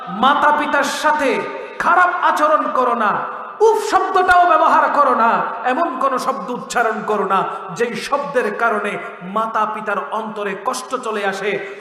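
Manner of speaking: ordinary delivery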